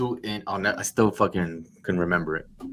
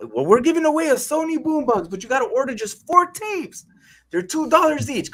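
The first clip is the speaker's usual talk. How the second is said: very high, excited sales spiel; hammed-up pitchman patter; eager telemarketer. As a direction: hype the giveaway to a customer. Acting out his sales pitch.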